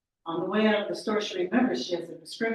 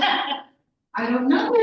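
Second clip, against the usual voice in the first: long, drawn-out high wail; hammed-up helpless cry; helpless outburst; 6 semitones higher, 9 dB louder, much slower than usual. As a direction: wail it slowly and loudly. Caller pressed, acts helpless outburst.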